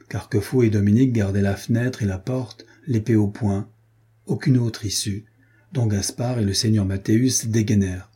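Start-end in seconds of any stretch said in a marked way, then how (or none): none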